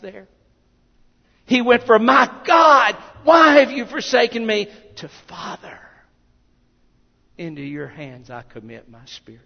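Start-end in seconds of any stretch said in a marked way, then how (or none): 2.0-4.7 loud, high shout of desperation; anguished preacher's shout, pitched up; desperate cry. Acted. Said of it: "of desperation, crying out". 5.3-9.4 hushed, low and soft prayer; soft, lowered voice, intimate; trusting surrender. Acted. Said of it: "to a point of relation".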